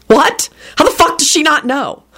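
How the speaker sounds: high-pitched disbelieving outburst; incredulous. A narrator's reading. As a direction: ask it in utter disbelief, pitch shooting up. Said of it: "gave her an incredulous look".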